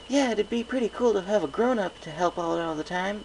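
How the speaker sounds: high eager filly voice; a little pegasus chiming in; young filly. A narrator's reading.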